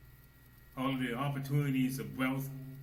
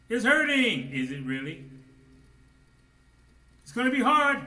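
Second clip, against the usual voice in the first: full-force preaching; 11 semitones higher, 11 dB louder than usual. Acted.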